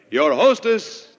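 ordinary talk

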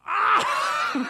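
loud, very high mock howl of laughter; hammed-up crowd reaction, high and loud; imitated outburst. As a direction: burst out in a long, shrieking cackle. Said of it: "they're just sort of like".

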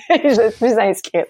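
slow words through a real laugh. Real, not acted. Laughing to the interviewer, slow and delighted.

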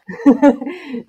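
bright amused laugh; real laugh. Real, not acted. Laughs about ten years at job.

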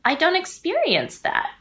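quoting her own thought up high, a touch of surprise; sudden realization. Retold or imitated.

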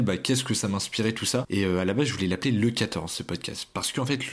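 normal talking voice

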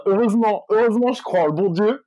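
mock the proud believer, half joking; smug believer. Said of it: "en disant".

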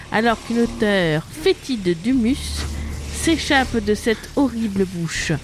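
normal talking voice

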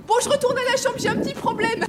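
awkward excuse. Acted. Calls back to friends, embarrassed.

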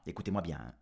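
ordinary delivery, nothing marked

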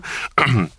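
short throat-clearing cough. Real, not acted. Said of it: "je m'étouffe".

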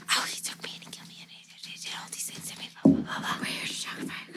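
rapid hushed whispering; ASMR whisper. Acted. Three women on the beach whisper to him, mock ASMR. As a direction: whisper it quickly, barely any voice. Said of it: "three bitches who are doing A S M R".

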